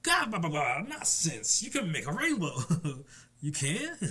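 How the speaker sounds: ordinary talk, nothing marked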